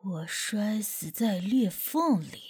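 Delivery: a confused ghost, barely above a murmur; dazed possessed voice. Acted. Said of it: "张龙的语气非常的迷茫".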